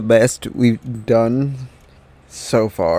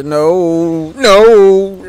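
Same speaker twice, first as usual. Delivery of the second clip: loud; 6 semitones higher, 8 dB louder than usual. Real, not acted. Failing in game, cries out.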